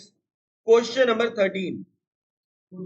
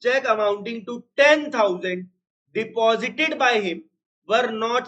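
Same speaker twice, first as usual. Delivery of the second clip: each word drawn out for writing; slow dictation; much slower than usual. Shown as a demonstration. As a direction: dictate it slowly to the class. Dictating the statement. (छात्रों को धीरे-धीरे लिखवाना)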